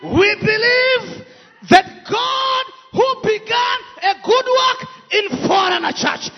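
fiery sermon delivery; preaching. Acted. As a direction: preach it with full force. Preaching with interpreter.

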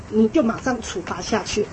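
ordinary delivery

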